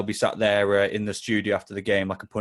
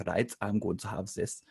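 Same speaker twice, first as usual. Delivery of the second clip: put-on German accent; 8 dB quieter than usual. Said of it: "so, the German guy".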